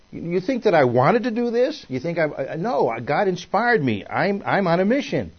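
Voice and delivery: high-pitched, incredulous pushback as the prophet; indignant protest. Acted. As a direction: protest that the calling was never your choice. Acts out Amos protesting to priest.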